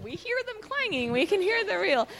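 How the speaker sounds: high, dry matter-of-fact reply; wry retort. Retold or imitated. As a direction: answer with amused obviousness. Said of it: "And I'm like".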